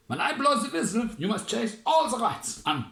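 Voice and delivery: high, loud drill order; commanding general. Acted. General ordering the soldiers to chase rats, high and loud.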